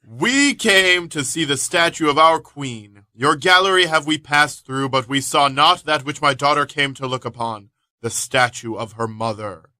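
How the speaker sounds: loud, slow Shakespearean declaiming; dramatic reading. Acted. [name] reads the statue scene aloud, loud and slow. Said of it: "[name] picks it up and reads from it".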